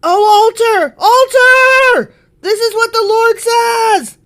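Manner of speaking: high, loud prophetic shout; shouted proclamation. Acted. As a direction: cry it out like a prophet, drawing out the words. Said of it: "he shouted".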